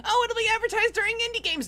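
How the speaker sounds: high, loud, quick eager self-parody; mock hopeful guessing. Mocking own hopes before E3, high eager voice. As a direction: rush it out in naive, high-pitched hope.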